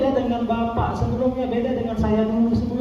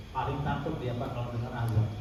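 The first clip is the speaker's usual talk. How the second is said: normal talking voice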